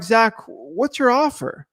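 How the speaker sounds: the calm version sellers never use; slow polite ask. Shown as a demonstration. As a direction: ask it lazily, no pressure. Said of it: "it's never like".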